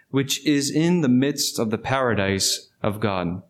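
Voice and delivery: measured, solemn Bible reading; formal scripture reading to the congregation. Acted. Scripture reading before sermon.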